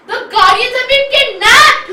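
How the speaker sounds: loud breathless announcement; alarmed shout. Acted. Teacher announcing the kidnapping, alarmed shout. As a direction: burst in with the shocking news.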